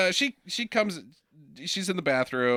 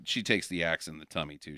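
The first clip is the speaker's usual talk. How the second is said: ordinary talk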